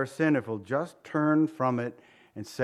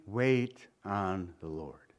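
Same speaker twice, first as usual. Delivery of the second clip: gentle counsel; 7 semitones lower, 5 dB quieter, much slower than usual. Real, not acted. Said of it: "preacher exhortation".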